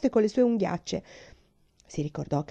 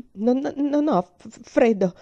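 slow, stuttering, higher and shaky with fright; teeth chattering; 5 semitones higher, 5 dB louder, slower than usual. Acted. Frightened girl insisting to her uncle she is not cold, stammering. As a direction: trip over the words as if teeth chatter. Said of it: "a cui battevano i denti".

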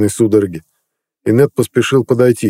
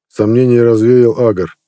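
usual speaking voice

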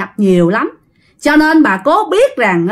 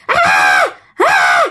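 possessed wail. Acted. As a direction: wail like a spirit in a rage. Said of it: "ông hai gào lên".

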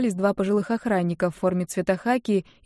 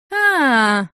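a loud, higher "aah" of sudden understanding; loud drawn-out realizing aah; 5 semitones higher, 6 dB louder than usual. Acted. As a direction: let it dawn on you out loud. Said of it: "догадалась наконец журавль".

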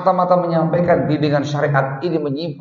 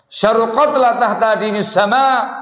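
sharp condemnation; 8 semitones higher, 3 dB louder, slower than usual. Retold or imitated. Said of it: "Itu bahasa yang sangat tajam".

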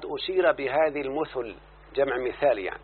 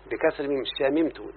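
normal talking voice

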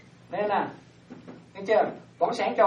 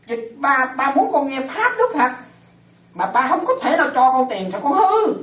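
raised, half-laughing father's complaint; mock pleading father; 6 semitones higher, 6 dB louder than usual. Fed-up father complaining to his spoiled son, mock pleading. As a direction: play a fed-up dad, lightly voiced.

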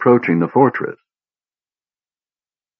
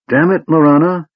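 higher-pitched exasperated outburst; flushed outburst; 9 semitones higher, 3 dB louder than usual. A narrator's reading. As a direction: snap it out in frustration. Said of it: "his face flushed".

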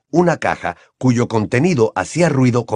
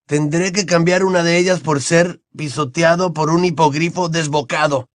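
put-on a lightly raised boyish voice; Ron's voice; 6 semitones higher than usual. A narrator's reading. Ron answers Hermione in a light boyish voice. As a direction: play the cheeky teenage boy.

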